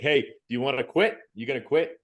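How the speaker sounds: imitating a medic pushing fast; pushy medic. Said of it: "So the medic asked me".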